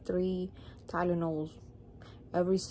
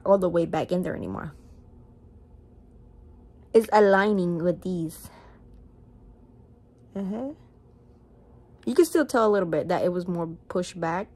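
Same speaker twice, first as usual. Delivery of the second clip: talking while pulling lip down; finger in mouth; 7 dB louder than usual. Real, not acted. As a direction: lip held open by finger. Seen 2: Finger hooks lower lip open, showing braces, through most labelled speech.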